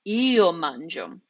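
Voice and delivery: slow io mangio, weight on io; emphasis on io. Shown as a demonstration. Teacher repeating io mangio, stressing io. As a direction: dwell on io slowly, stress it. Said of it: "I'm putting the emphasis on the I".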